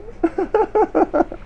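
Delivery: a high burst of laughter; a bright high laugh. Real, not acted. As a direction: laugh out in delight.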